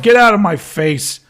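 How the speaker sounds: a hammed-up king yelling at visitors to leave; brash king voice. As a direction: shoo them off like a rude king. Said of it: "that's what the king sounds like".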